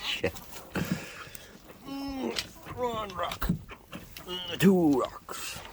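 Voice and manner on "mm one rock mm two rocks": high, soft, slow counting; soft high murmur. Real, not acted. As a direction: murmur gently to the birds.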